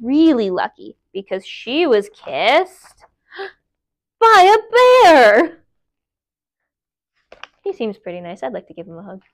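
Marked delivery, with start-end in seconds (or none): 4.2-5.6 loud, high, slow build to bear; dramatic slow reveal. Acted. Reader revealing the bear to listeners, slow dramatic reveal. 7.6-9.2 soft aside. Real, not acted. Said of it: "soft aside to kids".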